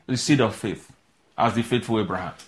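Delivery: normal talking voice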